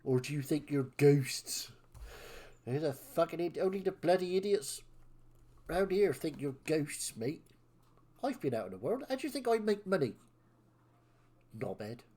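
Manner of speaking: scornful West Country villain; mock them in a West Country sneer. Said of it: "doing a villain voice in West Country".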